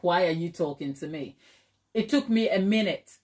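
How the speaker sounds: normal talking voice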